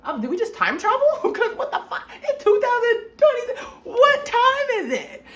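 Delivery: high, loud, laughing incredulous squeal; laughing disbelief. Retold or imitated. Retelling the guard's answer to her jail friends, laughing disbelief. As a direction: act out total confusion, cracking up. Baffled by the guard's military time.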